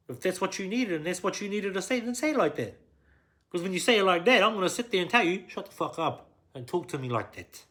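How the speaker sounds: loud, high angry tirade; forceful, worked-up telling-off; heated confrontation. Acted.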